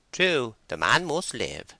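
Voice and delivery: assertive country wife. A narrator's reading. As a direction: butt in as a bossy wife. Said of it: "said a woman in the back quarter".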